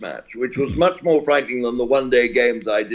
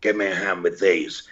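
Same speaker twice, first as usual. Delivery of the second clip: a hint of a broken-oak-tree old voice, lower; lightly voiced lower old commentator; John Arlott impression; 6 semitones lower than usual. Calling the new boy for help, lightly voiced gruff old man. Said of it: "a voice like a broken oak tree".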